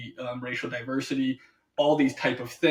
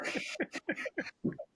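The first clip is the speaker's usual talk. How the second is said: high breathy laugh; 11 dB quieter than usual. Real, not acted. Laughing at own gloat.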